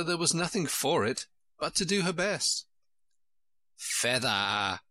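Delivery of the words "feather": called out by a sheep, drawn out; a mild, drawn-out cry of command. A narrator's reading. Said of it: "cried the sheep".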